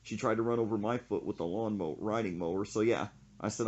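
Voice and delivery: ordinary delivery, nothing marked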